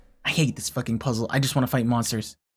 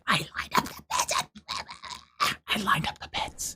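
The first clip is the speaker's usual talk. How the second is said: breathy whisper to herself; 7 semitones higher, 6 dB quieter than usual. Real, not acted. Repeating her puzzle step to herself, whispered. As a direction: whisper it under your breath, higher.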